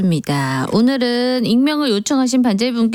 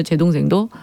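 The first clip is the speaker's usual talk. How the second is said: ordinary talk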